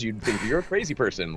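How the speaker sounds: ordinary talk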